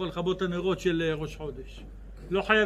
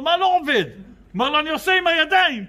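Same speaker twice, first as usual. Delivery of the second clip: exasperated worker; 7 semitones higher, 6 dB louder, faster than usual. Acted. Worker arguing in joke.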